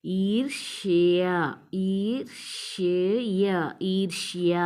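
ఈర్ష్య said slowly, ర్ష్య joined in one cluster; drawn-out ఈర్ష్య, all three sounds at once; three consonants together. Shown as a demonstration. Teacher repeats ఈర్ష్య slowly for the class. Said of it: "మూడు ఒకటేసారి పలకాలి".

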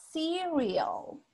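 cereal drawn out as SEE-ree-ol; English pronunciation. Shown as a demonstration. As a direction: model cereal slowly, stress on the first syllable. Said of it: "Así es la pronunciación".

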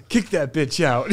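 put-on slow American accent, laughing through it; a laughing mock-American order to throw her out; fake American. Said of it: "Why did you turn American?".

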